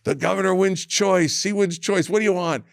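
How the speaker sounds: booth announcer hype. Retold or imitated. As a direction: announce the winner like a booth barker. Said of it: "they're like".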